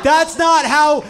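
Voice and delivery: high, loud laughing outrage; laughing exasperated rant. Real, not acted. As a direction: laugh while yelling in disbelief.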